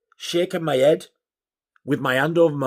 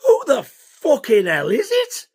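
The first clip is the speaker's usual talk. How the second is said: high, drawn-out disbelieving question; incredulous outburst; 10 semitones higher, 4 dB louder than usual. Retold or imitated. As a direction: demand it slowly, pitched right up. Retold demand to know caller.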